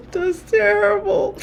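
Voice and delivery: very high, slow squeal of laughter; laughing hard. Real, not acted. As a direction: squeeze the words out while cracking up. Laughing at her own forgetfulness.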